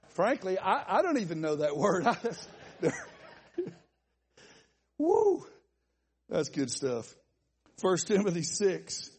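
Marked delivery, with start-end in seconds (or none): 5.0-5.4 whoop. Real, not acted. Laughing at his own story.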